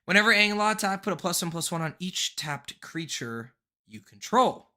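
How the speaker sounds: normal talking voice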